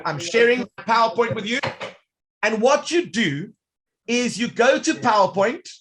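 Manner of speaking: ordinary talk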